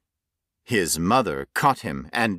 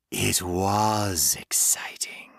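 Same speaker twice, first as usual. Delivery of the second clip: slow, hungry whisper; slower than usual. Acted. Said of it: "the creature whispered".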